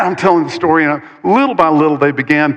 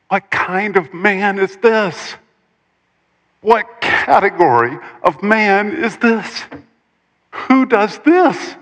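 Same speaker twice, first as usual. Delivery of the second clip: slow, high, amazed questions, climbing higher; awed astonishment; 6 semitones higher, slower than usual. Acted. Disciples asking one another about Jesus, awed astonishment. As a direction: let each question rise further in disbelief.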